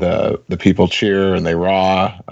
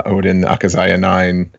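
ordinary talk

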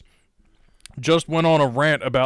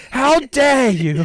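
slow, higher, louder hammed-up outrage; mock Greta outrage; 10 semitones higher, 5 dB louder, slower than usual. Mocking Greta for his co-host, slow and shrill outrage. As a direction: drag out the indignation like Greta.

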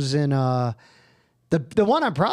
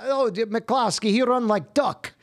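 lightly put-on Finnish accent, pitched higher; quick playful Finnish accent, broken English; a hint of a Finnish stage character, amused; 6 semitones higher than usual. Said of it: "And I had my Finnish accent".

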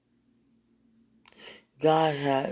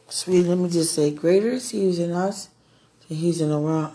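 preaching; 6 dB louder than usual. Real, not acted. Declares scripture to partner.

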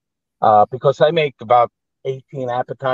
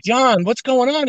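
confused wife; 13 semitones higher than usual. Retold or imitated. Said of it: "she's going".

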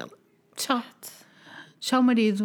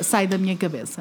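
ordinary talk

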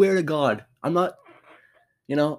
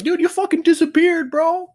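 much higher, hyped-up bro voice; excited buddy; 4 dB louder than usual. Retold or imitated. Buddy teasing the tiny player who disappeared under the pile, mock excited. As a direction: rib your friend with loud amazement. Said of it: "his buddy was like".

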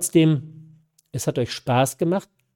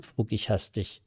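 ordinary delivery

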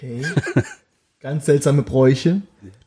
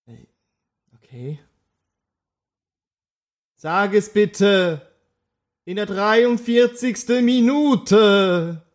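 solemn oracle voice; 4 semitones higher than usual. Acted. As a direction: intone it like a mystic revealing truth. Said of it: "Marco aus der Folge sechzehn".

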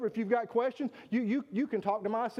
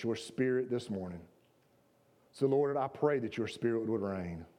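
prayer; 8 semitones lower than usual. Acted. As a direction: pray humbly over the congregation. Pastor leads congregation prayer.

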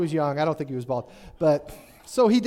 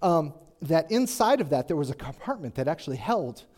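ordinary delivery

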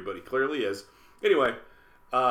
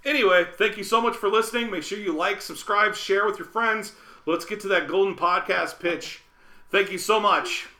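podcast outro; 8 semitones higher, 5 dB louder than usual. Acted.

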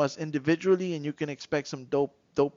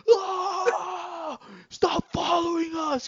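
high shouted yell, slower; Hulk yell; 14 semitones higher, much slower than usual. Retold or imitated. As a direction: scream him off like the Hulk. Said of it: "and he goes".

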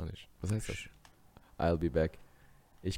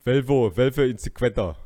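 put-on loud Spanish in a German accent; 8 dB louder than usual. Schwarzenegger's catchphrase in Spanish, heavy German accent. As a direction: punch out Spanish with hard German sounds. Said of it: "Warum hat er einen deutschen Akzent".

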